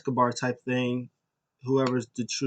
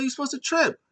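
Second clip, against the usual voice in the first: squeaky outrage; 13 semitones higher, faster than usual. Retold or imitated. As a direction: squeal it in disbelief. Drake scolding Killian Dane.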